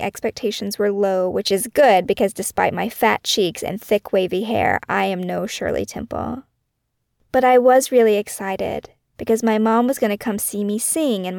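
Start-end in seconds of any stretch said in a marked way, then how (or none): none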